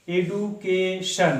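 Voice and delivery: Education said very slowly, split into এ-ডু-কে-শন with a pause after each syllable; teacher sounding out education one syllable at a time. Shown as a demonstration. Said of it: "এই কথাটা আমরা তিনবার উচ্চারণ করছি".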